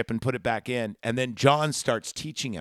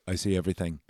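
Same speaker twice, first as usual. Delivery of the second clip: low, flat machine voice; deadpan robot line, pitched down; lightly voiced Terminator; 7 semitones lower, 6 dB quieter, much faster than usual. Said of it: "He goes".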